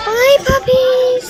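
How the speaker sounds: high, slow sing-song farewell; sing-song goodbye. Real, not acted. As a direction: croon it to the puppies. Calling goodbye to puppies.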